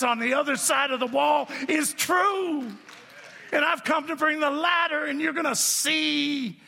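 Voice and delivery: high, driving sermon building to a shout; impassioned preaching. Real, not acted. Preacher promises congregation the fairy tales are true, building fervently. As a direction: preach it with mounting force, stretching the final words.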